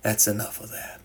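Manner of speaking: soft and firm; understated quiet insistence; quietly but adamantly. Acted. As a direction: close the subject gently but with no room for argument. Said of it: "quietly but adamantly".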